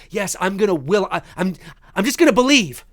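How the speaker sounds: mock wishful believer. Host mocking people who just believe, mock-earnest. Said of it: "we can't just go".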